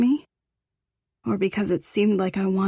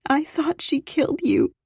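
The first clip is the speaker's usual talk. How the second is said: tearful; 8 semitones higher than usual. A narrator's reading. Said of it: "I said".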